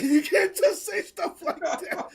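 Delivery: high, loud, drawn out through laughs; slow scolding that breaks into laughter; laughing protest. Real, not acted. Host objects to a stillbirth punchline, laughing protest. Cracking up repeating his reaction.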